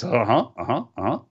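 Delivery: drawled uh-huh in an imitated voice; Swerve impression. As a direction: mimic Swerve's cocky uh-huh. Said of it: "Swerve appears and says".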